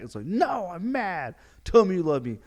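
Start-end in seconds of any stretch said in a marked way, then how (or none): 0.2-1.4 high, drawn-out mock whine; a child's stubborn refusal, hammed up; mad kid. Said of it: "the parent tells the kid". 1.6-2.4 louder, higher demand; raised-voice parental insistence; insistent parent. Acted. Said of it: "the parent tells the kid".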